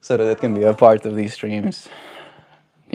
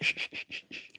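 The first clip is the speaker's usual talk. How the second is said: bird's shushing; 20 dB quieter, faster than usual. Retold or imitated.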